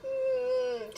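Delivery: high-pitched, tearful moan; long, pained little whine; high whimper. Real, not acted. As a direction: whimper as if about to cry. Moved to tears by scene.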